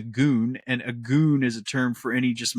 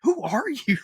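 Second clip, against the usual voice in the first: high, bewildered mock reaction; baffled what; 13 semitones higher than usual. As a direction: act thrown by the accent, pitched up. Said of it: "And I'm like".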